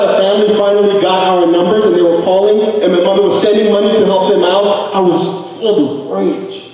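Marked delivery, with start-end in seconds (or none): none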